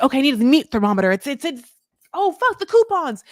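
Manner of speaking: fast, rising to a high squeal; flustered rush ending in a yelp; hammed-up kitchen panic; mock frantic. Frantic search in a strange kitchen.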